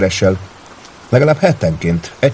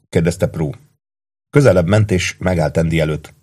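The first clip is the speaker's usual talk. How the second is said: ordinary delivery, nothing marked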